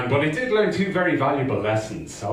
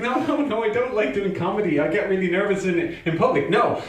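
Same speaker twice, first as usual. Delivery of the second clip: higher-pitched mock protest; agitated refusal; 6 semitones higher than usual. Refusing the friend's urging once more, agitated. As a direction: protest harder, imitating a flustered self.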